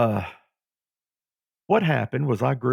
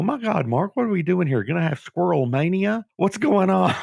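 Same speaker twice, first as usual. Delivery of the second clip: mock alarm; 7 semitones higher than usual. Retelling his startled reaction.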